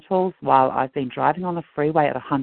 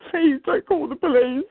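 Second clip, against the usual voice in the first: begging; 12 semitones higher than usual. Retold or imitated. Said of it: "and I begged him".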